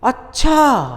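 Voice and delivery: high, astonished अच्छा, stretched then falling; surprised. Shown as a demonstration. Teacher demonstrating "can that really be?", surprised अच्छा. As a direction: draw out अच्छा in amazement. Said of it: "आश्चर्य हो रहा है आपको".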